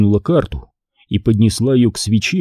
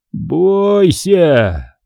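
raised, drawn-out ominous warning, fully voiced; 7 semitones higher than usual. A narrator's reading. Man warning the children, ominous. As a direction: stretch out the threat. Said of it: "прошептал он".

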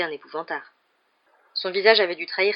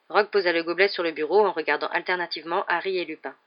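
ordinary delivery

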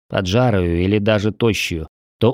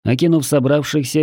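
ordinary delivery, nothing marked

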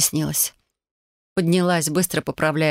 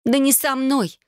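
higher, exasperated snap; annoyed; 9 semitones higher, slower than usual. Acted. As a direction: brush off the wrong guess. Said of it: "с досадой ответила Ленка".

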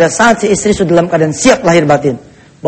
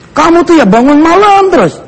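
spouse's nagging complaint; 9 semitones higher than usual. Acted. Husband grumbling at his devout wife, high mock nagging. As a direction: complain with stretched words, pitched up.

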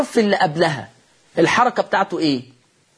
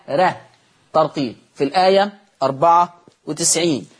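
normal talking voice

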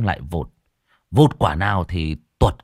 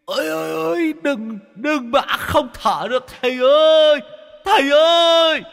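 very high, piercing scream, louder at the end; shrill screaming ghost; 4 dB louder than usual. Acted. Ghost drowning in the teapot shrieks to the master, very high. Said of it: "gào lên choe choé".

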